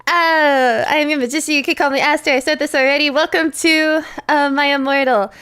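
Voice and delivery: bright high host greeting; stream intro. Acted. Streamer opening her show to viewers, upbeat host voice.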